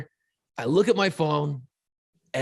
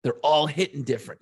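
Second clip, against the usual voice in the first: ordinary talk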